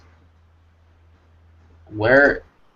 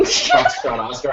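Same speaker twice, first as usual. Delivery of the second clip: dramatic name call; slower than usual. Acted. Calls out artist's name dramatically.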